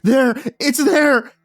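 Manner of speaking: very high, loud cry of terror; terrified shriek. A narrator's reading. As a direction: shriek it in sheer panic. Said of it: "The sobbing prisoners shrieked".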